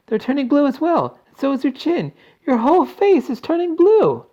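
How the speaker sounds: very high, loud, rising panic; horrified scream. A narrator's reading. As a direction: shriek each new discovery. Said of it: "screamed Missus Beauregard".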